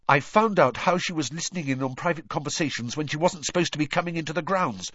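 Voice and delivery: fast, high, pouring out; in a rush. A narrator's reading. Hermione finally telling what she found out, in a rush. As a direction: blurt it all in one quick run. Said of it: "said Hermione in a rush".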